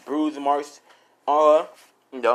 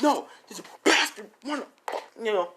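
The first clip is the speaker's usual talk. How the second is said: high, strained protest; high mock fury; acted angry refusal; 9 semitones higher than usual. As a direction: refuse to back off, pitched way up. Acted refusal to stop fighting.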